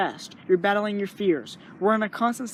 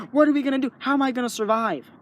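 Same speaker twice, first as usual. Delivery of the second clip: mock fear; 5 semitones higher, 5 dB louder, faster than usual. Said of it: "constant just going like".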